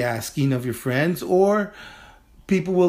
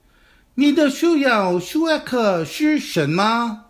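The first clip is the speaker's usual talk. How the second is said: slow, higher, carefully articulated Chinese for students to repeat; slow model sentence; 8 semitones higher, slower than usual. Shown as a demonstration. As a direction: model each syllable slowly, teacher style. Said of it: "Chengfu san, 快点".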